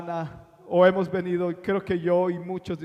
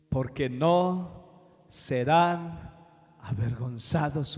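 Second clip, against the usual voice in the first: slow, low and hushed, each phrase drawn out; solemn scripture reading; 3 semitones lower, slower than usual. Acted. Preacher reads the promise to the congregation, slow and solemn.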